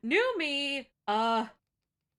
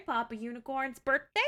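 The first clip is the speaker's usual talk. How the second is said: ordinary talk, nothing marked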